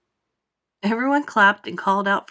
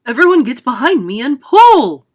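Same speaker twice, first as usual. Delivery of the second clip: much higher, very loud bark; shout; 4 semitones higher, 15 dB louder than usual. Acted. Grasshopper ordering everyone to haul, a huge shout. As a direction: shout the command. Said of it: "shouted the old green grasshopper".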